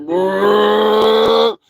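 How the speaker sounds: long, loud rising "whoa" noise; imitated plane lurch. Host mimicking a plane tipping, comic drawn-out groan.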